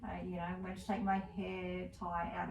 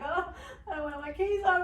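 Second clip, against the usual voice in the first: higher, fast mock wail; mock tearful; 10 semitones higher, 4 dB louder, faster than usual. Telling friend about tired mornings, mock tearful wail. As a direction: whimper it like you're about to cry. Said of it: "I can just burst out into tears".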